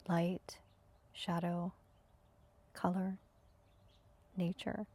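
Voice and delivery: calm, even meditation guidance with long pauses; spacious, unhurried meditation voice; guided meditation. Acted. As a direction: guide them into stillness, leaving space after each phrase. Leads breathing and senses meditation.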